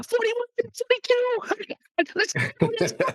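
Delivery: very high, squeaky play-calling; high-pitched mock quarterback cadence; chicken football calls. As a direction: call the play like excited little chickens. Acting chickens calling football plays.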